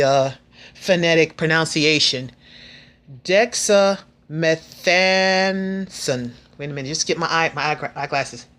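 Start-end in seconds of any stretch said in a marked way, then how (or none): 3.2-6.3 Dexamethasone drawn out syllable by syllable, very slow; said slowly. Shown as a demonstration. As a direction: spell out Dexamethasone slowly, each syllable stretched. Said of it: "I'm gonna try to say it slow".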